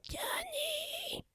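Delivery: high, very soft wheezy whisper; comical sick-friend voice. Retold or imitated. Friend teases host over the phone in a comical whispery sick voice. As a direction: put on a thin, breath-only sick voice. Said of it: "is he really gonna sound like this".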